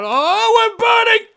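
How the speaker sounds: sudden high, loud alarmed shout; panicked cry. Acted. Barnabas crying out as the fish bursts into flames, high panicked shout. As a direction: yelp in alarm as the fire flares up. Fish catches fire mid-sentence.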